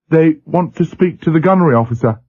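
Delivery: put-on a nervous young rating rushing it out; young seaman voice. A narrator's reading. Young seaman relays request.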